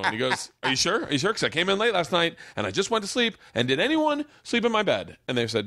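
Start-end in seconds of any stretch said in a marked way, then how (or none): 0.6-5.2 rising, pitched-up suspicious dad; a suspicious father getting higher; dad's suspicious questions. Retold or imitated. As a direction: press them like a suspicious father.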